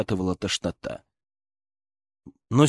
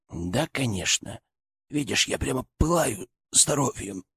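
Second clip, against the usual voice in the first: weak sarcastic son; 4 dB quieter than usual. Acted. Wounded son jokes.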